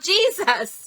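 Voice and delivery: high yell down the phone. Retold or imitated. Panicked daughter to her mother on the phone, urgent high yell. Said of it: "and I yelled".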